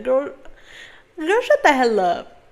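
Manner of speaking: loud, incredulous call-out; exasperated outburst. Real, not acted. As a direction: yell at the screen in disbelief.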